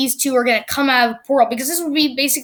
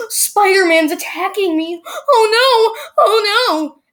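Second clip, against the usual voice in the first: high, loud fake panicked cries; mock panic; 8 semitones higher, 4 dB louder, slower than usual. Villain faking a victim in a video, hammed-up panic. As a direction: ham up a fake victim pleading. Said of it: "pretends to be like".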